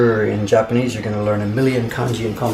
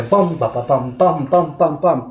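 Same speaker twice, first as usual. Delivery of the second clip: "bum, ba, ba, bum" as pounding stress beats; heavy English emphasis; 2 semitones higher than usual. Shown as a demonstration. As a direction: punch each "bum", pass lightly over "ba". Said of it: "using such an emphasis".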